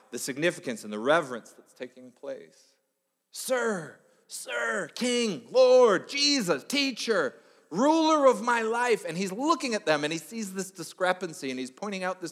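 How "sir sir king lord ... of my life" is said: higher and louder with every title, ending slow; fervent crowd calling Jesus higher and louder; mounting worship cry. Acted. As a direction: pile on the titles like a rising cry of praise.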